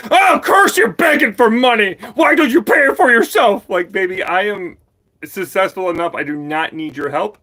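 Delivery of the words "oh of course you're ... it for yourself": much higher, loud sneering whine; high, loud mock of a rude commenter; mocking quote. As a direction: shout it back as a whiny know-it-all. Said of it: "he's like".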